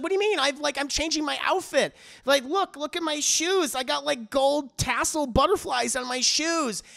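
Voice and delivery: high, whiny mock protest; mock defensive. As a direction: pitch it up and plead your case. [name] defending outfit.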